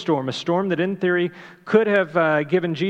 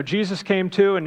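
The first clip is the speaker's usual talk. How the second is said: normal talking voice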